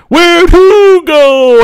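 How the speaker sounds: slow, high, dopey quoted reply; mocking Hollywood. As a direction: imitate the clueless wingman drawn out. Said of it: "Hollywood said".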